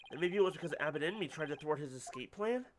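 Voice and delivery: ordinary talk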